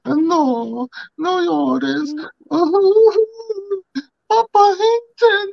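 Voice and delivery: very high, drawn-out Spanish wail; hammed-up high lovesick moaning. Friend imagining a woman consoling her man, high mock wail. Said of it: "it'd be like".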